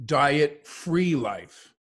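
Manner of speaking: diet-free life said very slowly, every word spaced and weighted; slow emphasis. Shown as a demonstration. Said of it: "Listen carefully to the words".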